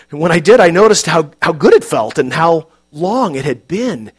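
ordinary delivery, nothing marked

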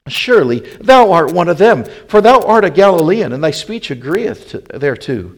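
loud, high-pitched accusing voice; insistent accusation. A narrator's reading. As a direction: press the charge home loudly. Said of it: "they that stood by said again to Peter".

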